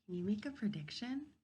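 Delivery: ordinary delivery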